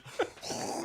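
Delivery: unvoiced rushing spray noise; imitated fire hose. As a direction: mimic a blast of water with the mouth. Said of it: "sounds like a fucking civil rights fire hose".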